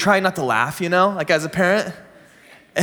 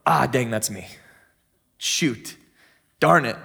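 sheepish self-recognition; 6 semitones lower, 3 dB quieter than usual. Acted. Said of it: "look at it as a mirror and go".

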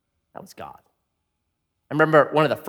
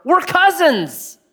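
high, slow, drawn-out; mock awkward announcement; 12 semitones higher, 4 dB louder, much slower than usual. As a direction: say it like a sheepish reveal. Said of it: "he says to her".

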